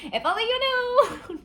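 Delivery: giggling mock tragedy; laughing through it. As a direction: lose it halfway through the melodrama. Repeat, breaking into laughter.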